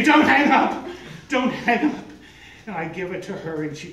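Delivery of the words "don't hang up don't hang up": much higher, louder urgent cry; frantic plea. Retold or imitated. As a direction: beg them not to hang up. Frantic on the phone.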